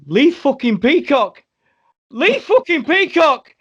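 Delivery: very high, loud, slow squeal; hammed-up fanboy outburst, stretched out; starstruck fan. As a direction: shriek the name in disbelief. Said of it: "all I see is Chris going".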